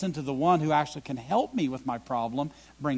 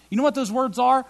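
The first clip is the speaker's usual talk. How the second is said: normal talking voice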